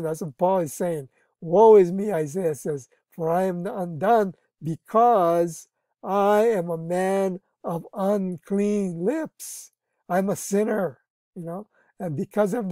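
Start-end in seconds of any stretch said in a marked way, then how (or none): 1.4-2.1 preaching. Acted. Quoting Isaiah again. 3.1-9.7 preaching. Acted. Isaiah's confession slowly read.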